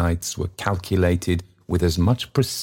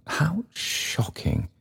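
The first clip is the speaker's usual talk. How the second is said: high, hushed, drawn-out gasp of shock; mock shock; 10 semitones higher, 6 dB quieter, much slower than usual. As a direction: act prettily shocked. Lady Bowley shocked.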